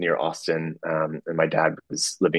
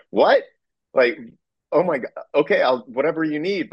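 higher, startled gasp of a brother; shocked brother; 10 semitones higher than usual. Retold or imitated. Brother startled by the request, higher and flustered. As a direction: throw out a stunned what then fumble for words.

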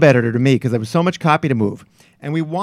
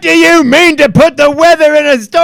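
high, loud Scottish editor ranting; hammed-up Scottish tirade; Scottish accent; 12 semitones higher, 9 dB louder than usual. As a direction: rant like an irate Scots sub-editor. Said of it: "he's just gonna go in there and say this".

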